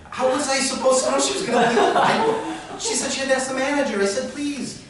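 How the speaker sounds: high, loud protest of innocence; pleading. Retold or imitated. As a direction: plead your case to an angry crowd. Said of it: "I'm suddenly pleading".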